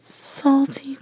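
usual speaking voice

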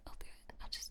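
barely audible whisper; defeated whisper. Acted. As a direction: breathe it out, broken. Gives in, repeats softly.

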